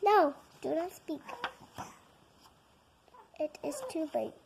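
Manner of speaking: ordinary delivery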